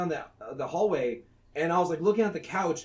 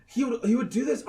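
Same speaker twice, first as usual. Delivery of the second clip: normal talking voice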